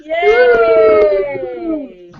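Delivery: high, loud yay; cheering yay. Acted. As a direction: cheer the win. Cheer after exercise.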